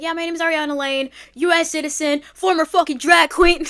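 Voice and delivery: loud joking brag; mock self-introduction. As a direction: announce it loud and proud as a joke. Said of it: "I'm gonna be like".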